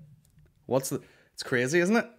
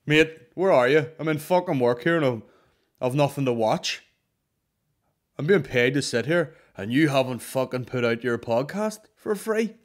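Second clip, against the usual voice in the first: loud, indignant listener complaint; mock angry listener; 3 semitones higher, 6 dB louder than usual. Fan at work demanding the late podcast, loud and indignant. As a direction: play the entitled fan moaning loudly.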